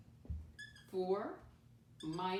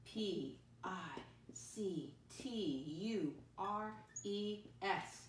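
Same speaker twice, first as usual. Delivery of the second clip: slow letter by letter spelling; much slower than usual. Shown as a demonstration. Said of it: "It's right up here".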